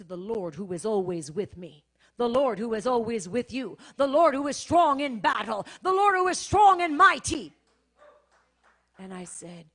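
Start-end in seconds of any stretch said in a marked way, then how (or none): 0.0-7.5 building from plain to a loud soaring cry; preaching climax. Acted. Preacher building to the mighty Lord, crescendo into loud preaching. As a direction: swell each line bigger toward a shout.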